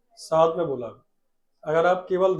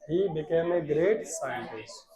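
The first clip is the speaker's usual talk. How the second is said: slow, drawn-out words; slow dictation; 5 dB quieter, much slower than usual. Shown as a demonstration. Teacher dictating slowly to the class. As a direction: stretch each word for the class. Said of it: "आगे सुनो बेटा".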